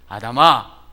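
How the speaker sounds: very loud, slow calling out; God calling Adam in Genesis, loud and slow. Retold or imitated. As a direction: call to Adam across the garden. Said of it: "하나님께서 아담에게".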